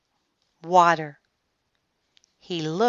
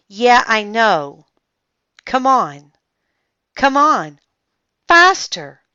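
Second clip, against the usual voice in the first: loud, higher and higher urging; urgent command; 5 semitones higher, 10 dB louder than usual. A narrator's reading. Boy orders the mini sub to go faster, loud and urgent. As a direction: push the sub on, louder each time. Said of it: "Jack ordered the mini sub".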